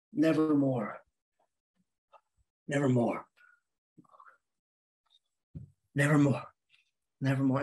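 low, gloomy muttered repetition; dejected, low-pitched moping; very unhappy person. Acted. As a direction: drone it low with long pauses, in misery. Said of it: "very unhappy person who just walked around all day going".

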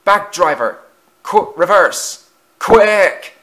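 loud commands rising to a high shout; urgent shout. A narrator's reading. Engine orders driver after runaway trucks, urgent shout.

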